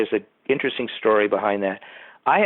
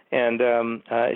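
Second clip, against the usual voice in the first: ordinary delivery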